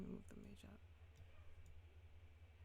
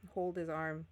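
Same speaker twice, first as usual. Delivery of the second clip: normal talking voice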